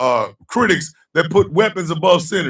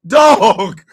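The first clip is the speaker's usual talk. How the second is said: usual speaking voice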